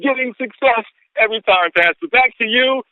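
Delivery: beaming with excitement; elated. Real, not acted. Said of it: "I am elated".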